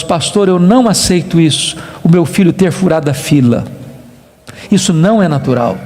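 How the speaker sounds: low, subdued retold grief; tearful. Bereaved doctor confiding in his pastor, tearful. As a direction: quote a grieving father, understated and low. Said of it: "disse pra mim com lágrimas nos olhos".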